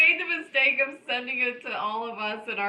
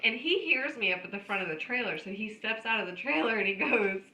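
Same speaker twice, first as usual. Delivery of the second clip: ordinary talk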